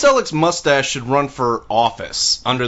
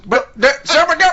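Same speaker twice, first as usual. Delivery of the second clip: put-on high-pitched, sputtering Western old-timer; sputtering old prospector; 3 dB louder than usual. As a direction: splutter like an agitated old prospector.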